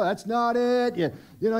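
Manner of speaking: ordinary talk, nothing marked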